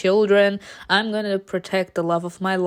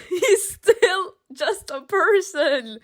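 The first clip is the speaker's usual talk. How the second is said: high, drawn-out plea through laughter; high incredulous whine with a laugh; laughing protest; 9 semitones higher, slower than usual. Real, not acted. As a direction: plead slowly, half laughing.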